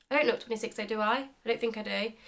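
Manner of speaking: ordinary delivery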